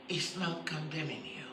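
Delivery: preacher gently repeating the comfort. Shown as a demonstration. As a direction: say it again, softly, to reassure. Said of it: "I'm gonna say that again".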